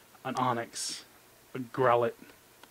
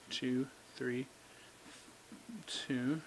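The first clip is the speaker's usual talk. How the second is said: hushed countdown; 13 dB quieter, much slower than usual. Real, not acted. Counting down before reveal.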